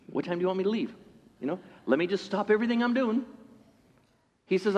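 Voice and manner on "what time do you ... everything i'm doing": sarcastic high "oh sure", mocking the elders; sarcastic mock reply. Elders scoffing at the centurion's request, sarcastic. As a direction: scoff as if brushing off a favor. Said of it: "they're not prone to go".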